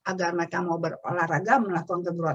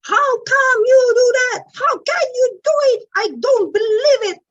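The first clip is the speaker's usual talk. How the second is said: high, loud scolding in English; shrill-free but sharply raised teacher scold; mimicking an angry teacher; 8 dB louder than usual. Parent mimics the class teacher scolding pupils, high loud scold. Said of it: "sering sekali ngomong begini".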